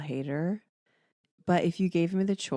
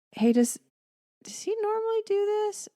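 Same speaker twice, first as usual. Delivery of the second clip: put-on high, puzzled kid voice, much higher; son's question. Mom retells her son's puzzled question, high. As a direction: imitate the son's startled question. Son asking about the cat.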